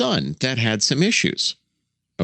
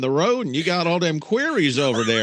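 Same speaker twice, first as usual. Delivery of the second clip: hammed-up good old boy, pitched up; folksy father-in-law type; 6 semitones higher than usual. Joker plays a man walking down the road, folksy father-in-law voice. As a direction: play an old guy pointing things out down the road. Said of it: "you sound like my future father-in-law".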